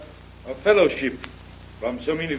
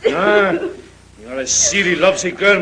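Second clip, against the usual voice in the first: teasing laugh; 3 semitones higher, 5 dB louder than usual. Acted. Father teasing his lovesick daughter.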